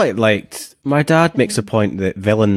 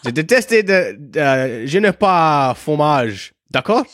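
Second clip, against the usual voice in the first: higher, slow hammed-up French tourist; fake French accent; 3 semitones higher, slower than usual. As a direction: ham up bad French, rising at the end. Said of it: "That's it. That's all I need".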